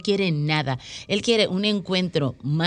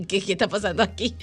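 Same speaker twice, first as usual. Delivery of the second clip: giggling, fast and a bit softer; laughing puzzlement; 3 semitones higher, 3 dB quieter, faster than usual. Pretend. Host laughs, pretending the audience wonders what's happening, amused. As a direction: laugh through a mock baffled question. Laughing at play's emotional swings.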